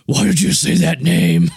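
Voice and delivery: loud, slow, overdramatic movie-hero demand; Ben Affleck impression. Mocking a film star's hero role, loud hammed-up demand. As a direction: ham up the actor's big dramatic line. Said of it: "And then we have Ben Affleck".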